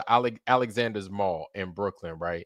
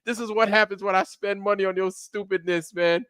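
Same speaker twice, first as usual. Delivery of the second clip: high, scolding mom voice, mildly hammed-up; 9 semitones higher than usual. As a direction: mimic an angry mother in the mall. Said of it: "She was like".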